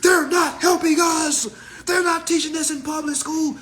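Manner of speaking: high whiny mockery of naive complainers; mocking whiny kids. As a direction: whine it up high like a complaining kid. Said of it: "stupid shit like".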